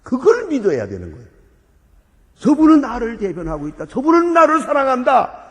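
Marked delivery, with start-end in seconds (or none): 2.3-5.4 loud, high proclamation of faith; fervent cry. Acted. Sinner declaring faith in the priest, fervent loud. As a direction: cry out with rising conviction.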